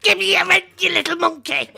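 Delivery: high shrill-voiced landlady yelling; landlady shouting. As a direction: scream it like an angry old landlady. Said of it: "she comes along and she goes".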